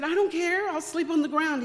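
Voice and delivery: loud, high-pitched backtalk; mouthy defiance. Retold or imitated. Daughter arguing with dad over scholarship, loud mouthy defiance. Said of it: "I was always kind of mouthy".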